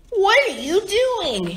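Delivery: high, indignant storybook yell. A narrator's reading. Bizarro angry at Superman for fixing things, high mock yell. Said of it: "he yelled".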